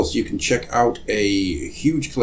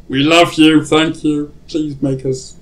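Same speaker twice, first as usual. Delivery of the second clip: gushing plea; 7 semitones higher, 5 dB louder than usual. Pretend. Pleading with listeners.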